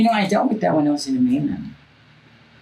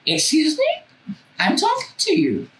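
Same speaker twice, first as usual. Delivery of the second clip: much higher, drawn-out indignant excuse me; indignant call; slower than usual. Retold or imitated. Calling after the worker who ignored her, indignant and high. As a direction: call after him, offended and shrill-high.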